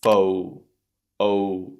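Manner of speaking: fo, drawn out with rounded lips. Shown as a demonstration. Teacher modelling the vowel for students, rounded O. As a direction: hold the long rounded o in fo. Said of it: "Keep your mouth rounded".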